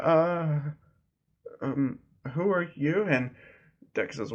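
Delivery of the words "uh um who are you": higher-pitched, hesitant mock nervousness; lightly voiced rattled stranger; freaking out. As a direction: stall on the filler, then ask uneasily. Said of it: "her just freaking out".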